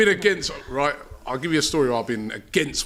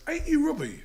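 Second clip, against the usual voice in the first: high, softer mimicked question; retold surprised recognition; 12 semitones higher, 6 dB quieter than usual. Hotel man spots a guest in the foyer, mock surprise. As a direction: lightly mimic a curious hotel clerk, high and soft.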